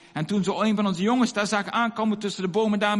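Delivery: normal talking voice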